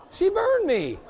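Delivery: high, loud, half-laughing cry; indignant outcry. Retold or imitated. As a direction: announce the burn in mock outrage. Retelling getting burned.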